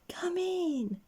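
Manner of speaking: put-on high, whispered girlish call; a little girl inviting someone in; cheerful call. Miss Fun welcomes Mister Grumble, high and whispery. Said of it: "she cried".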